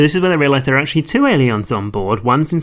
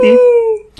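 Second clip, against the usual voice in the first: squeaky high voice; 7 dB louder than usual. Real, not acted. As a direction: jump way up in pitch. Jokey confession, voice jumps up.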